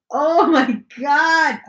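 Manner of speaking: loud, slow, drawn-out oh my God; excited dog greeting. Real, not acted.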